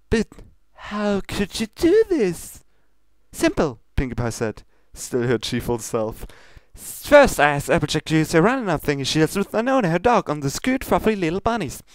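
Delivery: high, bubbly, fast pony voice; cheerful Pinkie Pie. Acted. Pinkie cheerfully explaining her bunny recipe, bubbly and quick. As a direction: chirp it out like a giddy party pony.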